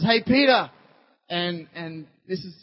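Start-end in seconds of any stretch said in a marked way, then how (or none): 0.0-0.7 raised, louder call to someone across the way. Retold or imitated. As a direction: call out to get his attention. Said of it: "Jesus calls out and says".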